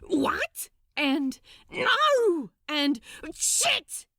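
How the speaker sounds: high, loud spluttered yelps of shock; spluttering outburst. Acted. Crowley sees the stoker step into the boiler, shocked yelp. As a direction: blurt it all in one gasp of alarm. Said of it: "Crowley spluttered and gasped".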